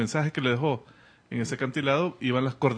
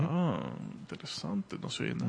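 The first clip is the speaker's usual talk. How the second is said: quiet; 3 semitones lower, 9 dB quieter than usual. Retold or imitated. Quoting characters hearing silence.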